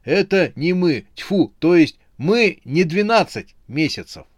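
high, flustered denial; frightened squeal. A narrator's reading. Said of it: "с перепугу взвизгнул он". (испуганно, повизгивая)

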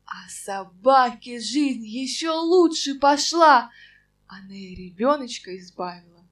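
ordinary delivery

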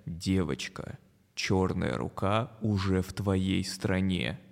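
low, slow menacing voice; creepy broadcast voice, drawn out; scary voice. Acted. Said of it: "страшным голосом говорят".

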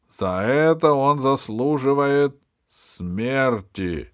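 loud, high, declaimed indictment; ringing prosecutor's oratory; accusing bishop. Acted. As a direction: proclaim each charge to the whole hall, drawing out the verdict.

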